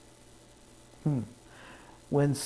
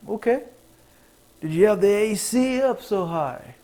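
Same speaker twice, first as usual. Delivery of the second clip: higher, louder fake-helpful voice; mock helpful tech support; 7 semitones higher, 6 dB louder than usual. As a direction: play the cheerful support agent. Acting himself on phone call.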